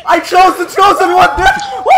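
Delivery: loud excited shout; triumphant shout. Real, not acted.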